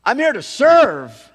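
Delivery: loud, slow, high proclamation; preaching shout. Acted. Pastor proclaiming loudly to the church. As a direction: ring it out to the whole church. Preaching climax, then quiet repeat.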